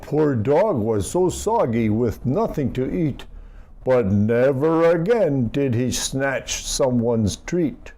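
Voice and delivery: plain reading voice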